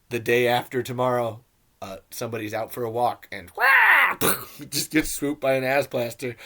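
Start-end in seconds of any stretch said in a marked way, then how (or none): 3.6-4.7 sudden loud, high imitation squawk; mock scream. Reviewer acting out a walker getting swooped, loud high mock scream. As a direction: yelp as if snatched up by a creature. Mimics a victim being snatched mid-walk.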